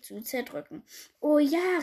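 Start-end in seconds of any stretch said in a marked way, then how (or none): 1.2-1.8 high, loud, eager cry; acted delighted call. A narrator's reading. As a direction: pipe up loudly in agreement. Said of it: "rief seine Frau".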